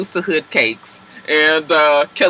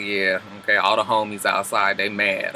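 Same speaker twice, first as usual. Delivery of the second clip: low, soft, deadpan grumble; a flat, unimpressed mumble down low; low Derek voice; 8 semitones lower, 7 dB quieter than usual. Retold or imitated. Imitating Derek's low unimpressed reply at the shower. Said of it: "Derek is like".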